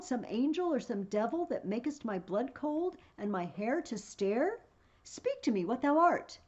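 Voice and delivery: high, loud, frightened cries at the ghost; terrified Brutus. Acted. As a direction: shout your fear at the apparition.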